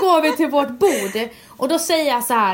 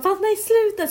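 higher, mock pleading "no, stop"; pleading boyfriend; 10 semitones higher than usual. Imagined boyfriend begging her to stay, higher voice. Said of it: "han skulle bara".

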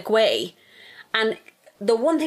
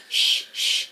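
breathy mock shush; whispered shushing; imitated shushing. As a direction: hush someone mockingly. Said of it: "We're meant to be".

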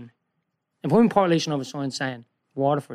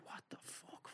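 under-the-breath whisper; breathless whispered swear; whispered aside; 34 dB quieter, slower than usual. Retold or imitated. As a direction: mutter it so the driver can't hear. Muttered reaction inside the Russian driver's car.

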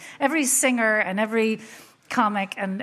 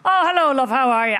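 dad's phone voice; 3 semitones higher, 6 dB louder than usual. Retold or imitated. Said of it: "I said".

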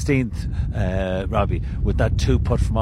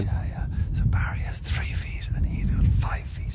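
whispering; 5 dB quieter than usual. Real, not acted. Hushed golf commentary beside green.

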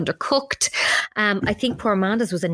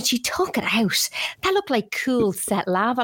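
whisper; 3 semitones higher than usual. Real, not acted. Gossiping about burnt pudding.

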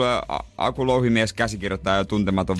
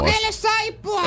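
high, slow shouted mom line; mock mom voice; slower than usual. Mother calling advice to her son, high slow shout.

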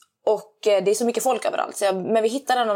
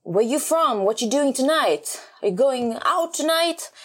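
high, slow mock American questions; pushy American guy; 5 semitones higher, much slower than usual. Stranger asking her plans, higher, slower mock American. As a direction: press her with eager questions. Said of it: "Han bara".

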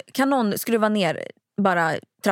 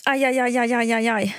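real pain. Real, not acted. Ring stuck while pulling it off.

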